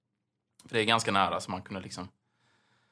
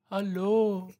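high, drawn-out call from far off; distant neighbor's call; 10 semitones higher than usual. Retold or imitated. Imitating the old neighbor calling hello through the wall. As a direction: call out a faint hello from another flat.

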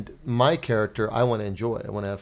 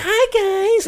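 much higher, squeaky fairy greeting; mocking a friend's fairy voice; 6 dB louder than usual. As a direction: chirp it like a tiny fairy. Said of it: "speak in his fairy voice".

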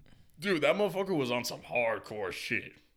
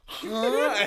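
dumbfounded huh; 9 semitones higher, 6 dB louder than usual. Retold or imitated. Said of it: "I'm like".

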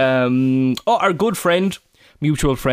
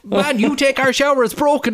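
put-on child shouting; 14 semitones higher than usual. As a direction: shout it like an excited toddler. Toddler shouting again.

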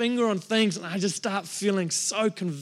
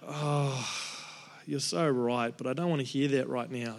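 grudging sulk; 6 semitones lower, 6 dB quieter than usual. Retold or imitated. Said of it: "at times was just like".

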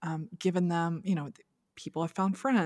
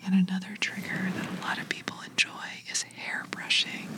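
ASMR whisper. Shown as a demonstration. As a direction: whisper gently, like soothing ASMR. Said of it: "whisper into your ear".

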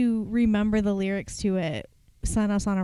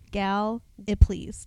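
"Gal-, it please" broken after a stressed GAL; first-syllable stress; slower than usual. Shown as a demonstration. Host coaching co-host on Gallipolis, emphasis on GAL in "Gal-, it please". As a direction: sound out "Gal-, it please", first syllable set apart, ending like "please". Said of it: "Emphasis on the first syllable".

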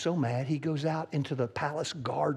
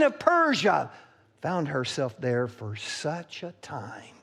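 slow, drawn-out, weighty words, fading to a breath at the end; solemn challenge; 11 semitones higher, 4 dB louder, slower than usual. Acted. Mordecai gently challenging Esther, slow and solemn. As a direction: let each phrase land heavy and slow.

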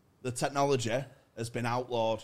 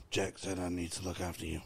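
deadpan stoic; 5 semitones lower, 6 dB quieter than usual. Acted. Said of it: "he doesn't have any emotion".

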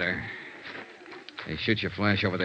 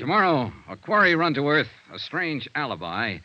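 hook them for tomorrow's episode; punchy radio trailer read; announcer promo; 4 semitones higher than usual. Acted. Star's promo for next episode.

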